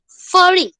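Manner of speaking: mock horror at the word Forty; shocked exclamation. Real, not acted. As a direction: repeat the number in disbelief. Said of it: "Oh my God".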